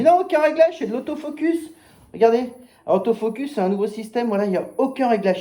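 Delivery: reliving his binocular pitch with high excitement; excited sales pitch. Retold or imitated. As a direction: sell it hard, pitch jumping high. Retold sales pitch to customer.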